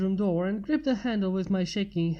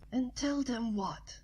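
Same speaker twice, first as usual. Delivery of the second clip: slow, higher, soft whisper; hushed retort to a panicking friend; countering whisper; 3 semitones higher, 7 dB quieter, slower than usual. A narrator's reading. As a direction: whisper it back pointedly. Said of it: "I countered".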